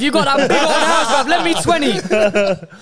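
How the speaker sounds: high, laughing mock beggar; whiny cheeky plea for cash; begging voice. Mock begging rich friend for cash.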